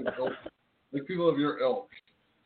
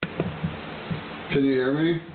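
slow, spelled-out; much slower than usual. Real, not acted. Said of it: "A little bit better".

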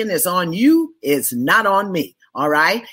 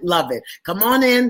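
ordinary delivery

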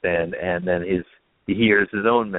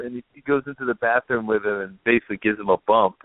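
ordinary talk, nothing marked

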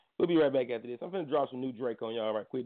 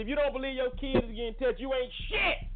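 high-pitched, heated rebuke; indignant scolding; 11 semitones higher than usual. Real, not acted. Radio host scolds parents who don't believe their kids, indignant. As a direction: tell them off with your pitch jumping up.

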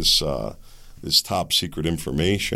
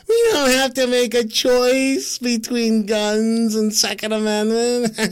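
much higher, slow and louder mock oratory; mocking a politician; 5 dB louder than usual. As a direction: ham up her speech, pitched up and drawn out. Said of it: "I wonder if she giggled when she said that".

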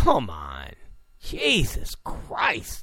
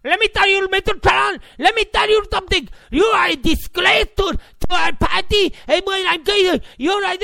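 high-pitched, ranting mock accent; hammed-up heavy accent, shrieked in outrage; mocking foreign accent at a frantic high pitch; Ana Navarro; 12 semitones higher, 4 dB louder than usual. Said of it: "horrible accent".

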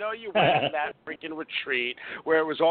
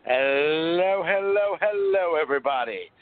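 loud greeting; 6 dB louder, slower than usual. Real, not acted.

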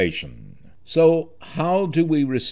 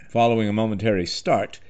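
usual speaking voice

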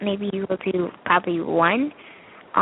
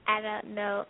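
suppressed giggle, held back; trying not to laugh; 7 dB quieter than usual. Real, not acted. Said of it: "I'm trying not to laugh".

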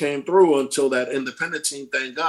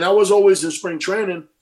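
usual speaking voice

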